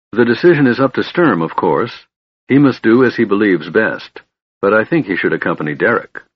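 usual speaking voice

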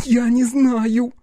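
high, drawn-out frightened cry. A narrator's reading. Scared girl answers her questioners, crying out. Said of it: "вскрикнула Полина".

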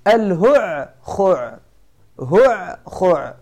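الهع خع drawn out very slowly, each throat sound rasped apart; هع خع stretched out syllable by syllable from the throat; slow demo of hu'khu'. Shown as a demonstration. Teacher slowly spelling out a clashing word for students, slow throat sounds. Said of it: "شوف".

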